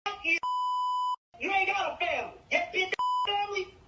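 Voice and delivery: usual speaking voice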